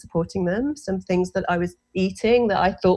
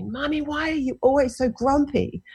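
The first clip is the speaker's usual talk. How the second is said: put-on child shouting back; 8 semitones higher than usual. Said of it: "she shouted back at me".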